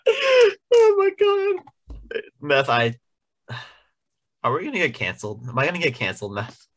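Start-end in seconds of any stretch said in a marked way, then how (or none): 0.1-2.2 very high, drawn-out cry of alarm; a real shriek of horror at himself; panicked shout. Real, not acted. Panicked outburst after offensive joke.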